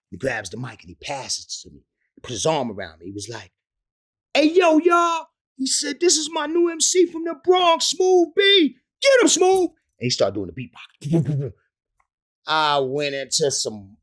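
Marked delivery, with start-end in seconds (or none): 4.3-9.7 high, loud stage hype; imitating Bobby calling to the crowd; hype-man call. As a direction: introduce your new MC to the crowd. Bobby hypes the crowd, introducing rapper.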